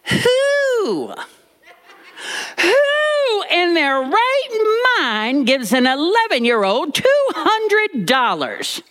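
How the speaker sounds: very high, slow, scandalised shriek of a question; outraged disbelief, voice shooting up; horrified granny. Retold or imitated. Granny horrified at the mother's choice, high and slow. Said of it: "Granny was horrified".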